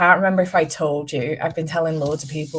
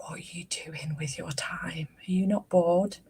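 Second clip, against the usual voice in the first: whispered mock question; 7 dB quieter than usual. Retold incredulous question to mum.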